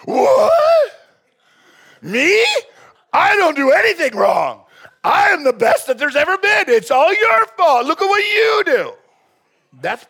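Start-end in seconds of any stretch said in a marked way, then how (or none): 0.1-8.9 high, loud, indignant yelling denial; outraged Laban shout. Retold or imitated. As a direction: shout it like a wounded narcissist refusing blame. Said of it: "You're gonna get a Laban reaction".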